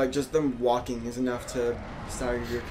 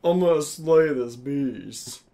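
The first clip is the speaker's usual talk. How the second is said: mock hero; 5 dB louder, much slower than usual. Mock heroic boss line.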